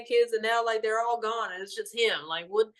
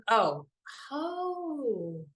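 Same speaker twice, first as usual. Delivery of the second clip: real tears breaking through; a soft, high, choked oh; crying; 3 semitones higher, 4 dB quieter than usual. Real, not acted. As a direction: overcome and starting to cry. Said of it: "I'm not crying no more".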